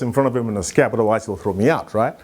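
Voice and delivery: normal talking voice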